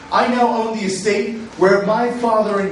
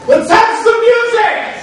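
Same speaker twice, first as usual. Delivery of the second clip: loud call; 13 semitones higher, 7 dB louder than usual. Acted. Drunken repeated call for music.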